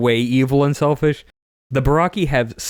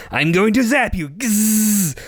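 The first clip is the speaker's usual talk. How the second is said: higher voiced threat, then a buzzing zap noise; mock villain threat; 9 semitones higher, slower than usual. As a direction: play a cartoon baddie zapping. Villain Alec threatens zapping.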